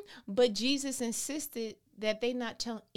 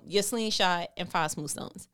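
ordinary delivery

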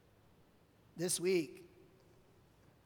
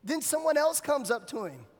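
ordinary talk, nothing marked

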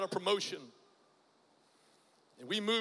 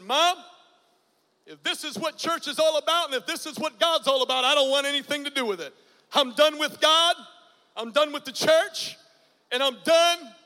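raised, louder voice, hurt and defiant; despairing outburst; 4 semitones higher, 6 dB louder than usual. Retold or imitated. Son tells his mother he is done with God, loud despairing outburst. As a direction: throw it back at her, fed up with church.

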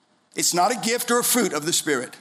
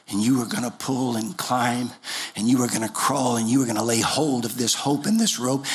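voice of the Lord; 8 semitones lower than usual. Retold or imitated. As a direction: speak as God, steady and deep. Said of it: "He said".

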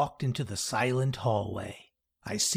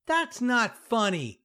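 pouting; 9 semitones higher than usual. A narrator's reading. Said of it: "begins to pout".

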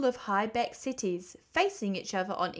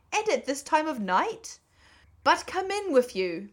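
high, loud, fussing welcome; exclaiming host, swooping high and loud; astonished, welcoming Badger; 7 semitones higher, 6 dB louder than usual. A narrator's reading. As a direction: usher them in with shocked concern. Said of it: "in quite a different voice".

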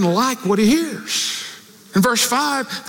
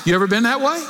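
usual speaking voice

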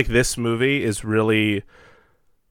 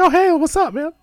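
sapaan riang; 4 dB louder than usual. Acted. Acted greeting to a returning character.

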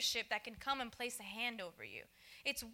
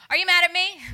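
much louder, pressing the question; louder insistent asking friend; insistent repeat; 4 semitones higher, 16 dB louder than usual. Retold or imitated. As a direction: friend demanding an answer this time. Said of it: "Yeah, okay".

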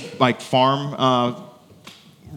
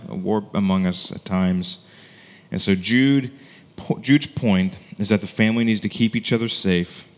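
ordinary talk

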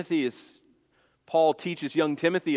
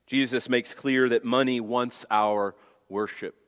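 ordinary delivery

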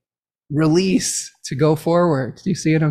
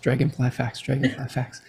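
quick, quieter repeat, trailing off; tongue-twister speed; 2 semitones lower, 5 dB quieter than usual. Shown as a demonstration. Host playfully testing a tongue twister, said fast. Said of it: "say that ten times fast".

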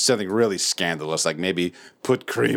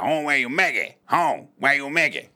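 mumbled Southern; 7 semitones higher than usual. Retold or imitated. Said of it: "the guy who's just indiscernible".

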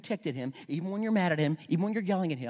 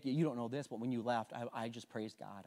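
ordinary talk, nothing marked

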